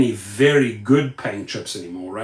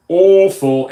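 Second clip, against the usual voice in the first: "Awful" drawn out loud, first syllable punched; emphasis on awful; 3 semitones higher, 7 dB louder than usual. Shown as a demonstration. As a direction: lean hard and loud on "Awful". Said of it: "Emphasis on".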